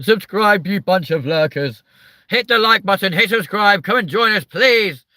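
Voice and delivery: high, loud call to viewers; loud, high channel plug; shouted plug. Real, not acted. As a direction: yell it at the lurkers.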